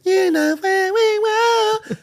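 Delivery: put-on very high, slow girly voice; girl's voice. Man mimicking a girl's voice for the camera. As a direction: squeak it out like a little girl. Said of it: "but in the girl's voice".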